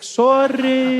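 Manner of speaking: high, loud, drawn-out sorry; sympathetic crowd. Acted. South Africans fussing over someone who fell, gushing sympathy. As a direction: gush it out, long and high. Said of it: "They'll all be there like".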